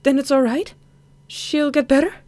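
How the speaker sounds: cling to hope against the doctor; desperate pleading. Acted. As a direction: daughter begs doctor that mother will recover.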